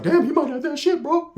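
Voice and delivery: high-pitched mock shock; mock alarm. Attackers hear Pepe's bloody threat, mock alarm. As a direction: squeal it like a spooked onlooker.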